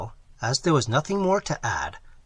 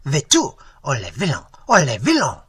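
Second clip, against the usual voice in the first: scolding the dog; 7 dB louder than usual. A narrator's reading. Landlady scolds the poodle, rising loud anger. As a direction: tell off the bad dog, louder each time. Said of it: "she cried with a slap".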